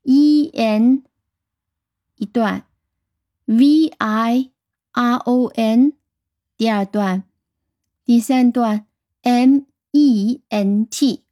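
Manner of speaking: E N, V I R O N, M E N T spelled in three slow chunks; teacher chunking the spelling to aid memory. Shown as a demonstration. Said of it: "用三段法来记住就更好了".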